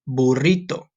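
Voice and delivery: "Burrito" with a trilled r, boo-RREE-toh; rolled r. Shown as a demonstration. Teacher drills the rolled r for the class. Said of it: "some of you who can't roll your R's".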